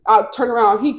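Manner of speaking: ordinary delivery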